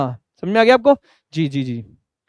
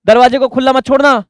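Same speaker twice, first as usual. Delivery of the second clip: lightly acted mum calling from downstairs; louder, higher mock motherly reminder; 4 semitones higher, 7 dB louder than usual. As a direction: raise pitch and volume a little, like a calling mother. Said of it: "mummy बोल रही है नीचे से".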